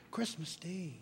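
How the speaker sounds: calm her down gently; mild answer. Acted. Husband softly calms his angry wife, mild and quiet. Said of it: "was Bob's mild answer".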